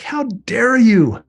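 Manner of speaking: berating her. Retold or imitated. Grieving husband scolding his dead wife at her grave, slow and heated. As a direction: stretch out each word as a bitter challenge. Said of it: "I'm berating her".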